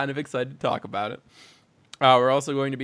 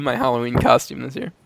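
ordinary talk

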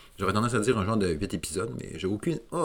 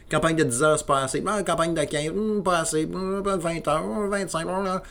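mock demanding gamer; 8 semitones higher than usual. Mocking gamers who want more.

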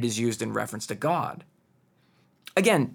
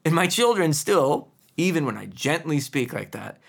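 usual speaking voice

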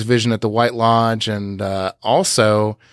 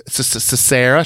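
imitated stutter; faster than usual. Host showing how Carrie says Sarah, stuttering. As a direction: stammer on the first sound. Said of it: "she stutters".

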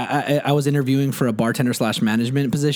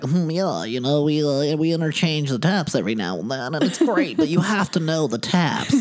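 mock beer-snob manager voice; beer snobby. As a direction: imitate a self-important craft beer bore. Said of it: "like, beer snobby".

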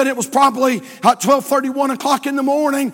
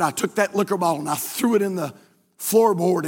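ordinary talk, nothing marked

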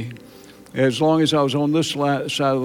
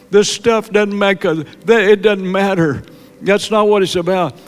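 fervent preaching; 5 semitones higher, 3 dB louder than usual. Real, not acted. Pastor warns congregation that only God's pleasure matters, fervent preaching. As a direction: drive it home from the pulpit.

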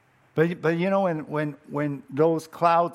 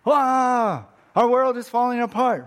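mock despair; 8 semitones higher, 6 dB louder than usual.

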